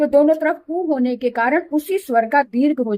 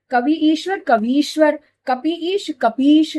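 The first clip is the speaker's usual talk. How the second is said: slow split; 2 semitones higher than usual. Shown as a demonstration. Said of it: "teacher demonstrates the word".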